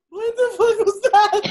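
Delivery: high, laughing what-was-that; cracking up at a friend's silly accent; laughing disbelief. Real, not acted. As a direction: laugh it out in delighted shock. Stunned by the sudden accent.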